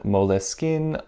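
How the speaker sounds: Moleskine as "mo-leh-skin", three syllables; added middle "leh", short i in Moleskine; alternative pronunciation. Shown as a demonstration. Listing viewers' suggestions, Moleskine said "mo-leh-skin". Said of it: "other recommendations for how to pronounce this".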